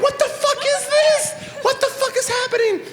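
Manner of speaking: high squealing disbelief with a laugh; baffled laughing squeal. Pretend. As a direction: shriek it, laughing in confusion. Baffled runner voiced.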